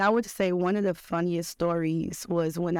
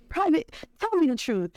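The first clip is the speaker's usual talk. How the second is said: drill sergeant; 10 semitones higher than usual. Retold or imitated. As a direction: bark it like a drill sergeant demanding the truth. Drill sergeant demands the truth.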